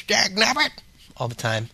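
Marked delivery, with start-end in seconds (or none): 0.0-0.7 put-on high, twangy old prospector cry. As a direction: exclaim like a grumpy old gold miner. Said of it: "old prospector that says".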